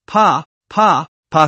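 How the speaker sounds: three sharp mouth-made slap noises; slap sounds. A narrator's reading. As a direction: smack out each slap with the mouth. Narrator voices three slaps.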